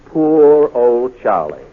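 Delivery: ordinary delivery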